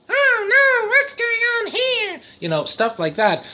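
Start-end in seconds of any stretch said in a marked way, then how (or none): 0.1-2.2 very high cartoon squeal; hammed-up falsetto alarm, drawn out; silly cartoon voice. Shown as a demonstration.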